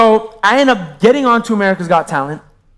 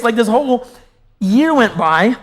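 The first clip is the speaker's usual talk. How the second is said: ordinary talk, nothing marked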